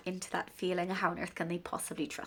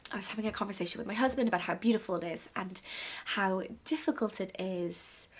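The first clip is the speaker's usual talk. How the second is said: ordinary talk, nothing marked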